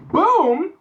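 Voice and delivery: big loud high boom; showy reveal. Pretend. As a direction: punch it out like a grand unveiling. Showy reveal of the pizza brand.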